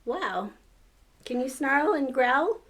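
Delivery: ordinary delivery, nothing marked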